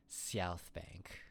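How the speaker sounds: "South Bank" with a long hissing S; snake-like S. Shown as a demonstration. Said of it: "I have to enunciate the S like I'm a snake".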